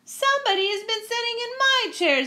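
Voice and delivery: very high, quick little-bear squeal; Baby Bear. Acted. Baby Bear finding his chair used, very high upset voice. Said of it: "said Baby Bear".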